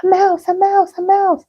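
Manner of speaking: high, loud frightened scream. Acted. Mother on a chair screaming about the mouse.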